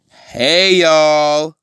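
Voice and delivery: high, drawn-out villain greeting; acted-out high holler; calling out. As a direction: hail the whole group, stretching it out. Villain hails the group.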